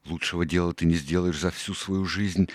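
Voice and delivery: plain reading voice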